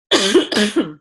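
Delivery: hard throat clear. Real, not acted. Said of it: "I think I just swallowed a gnat".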